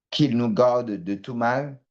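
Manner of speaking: usual speaking voice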